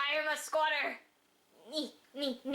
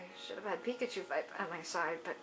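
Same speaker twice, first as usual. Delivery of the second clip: low, quiet character voice; 6 semitones lower, 6 dB quieter than usual. Acted. Reads a character's dialogue.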